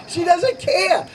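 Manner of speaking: normal talking voice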